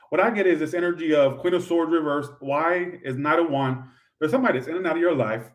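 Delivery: ordinary talk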